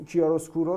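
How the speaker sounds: mock-showy Italian "کیاروسکورو", stressing the SKO; Italian-style pronunciation. Shown as a demonstration. Host teasing listeners who show off with Italian pronunciation. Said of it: "کیاروسکورو رو کیاروسکورو مثلاً تلفظ کنن".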